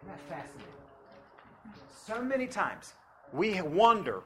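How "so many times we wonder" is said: preaching at full force. Acted.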